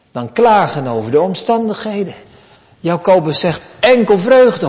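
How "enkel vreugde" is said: emphatic exclamation. Retold or imitated. Preacher voices James's exhortation.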